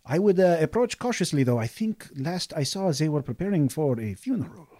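a merchant keen to help and sell; eager desert merchant. Acted. Merchant warns about the funeral.

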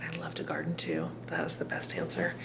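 soft breathy whisper; hushed whisper; whispered aside. Real, not acted. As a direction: lean in and whisper it. Whispered aside after answer.

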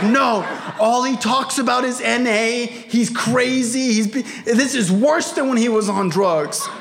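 exasperated dad. Retold or imitated. Dad ranting to son.

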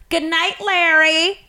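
slow, drawn-out farewell in a put-on old-guy voice; old man's goodbye. Retelling an old man calling goodnight to Larry, slow mock voice. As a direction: stretch out the goodnight like a barfly leaving.

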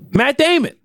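high, hyped-up name announcement; sudden high-pitched hype shout; mock fanfare. Mock fanfare for the film star.

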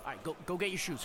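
ordinary talk

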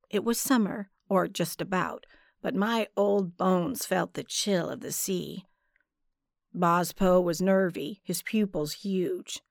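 plain reading voice

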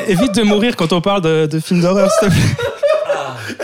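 laughing through the words. Real, not acted. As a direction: tease while cracking up. Laughing after friend chokes.

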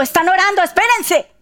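high, loud, hurried order; urgent halt. Acted. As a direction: stop everyone in a high, excited voice. Heaven halts everything for prayer. (orden urgente, aguda y alborotada)

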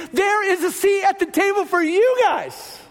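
impassioned proclamation. Real, not acted. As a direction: proclaim it like an open invitation.

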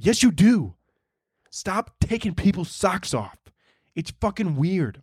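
acted, fed-up robber argument; exasperated scolding. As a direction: lay down the law to your accomplice. Acted robbers' quarrel over socks.

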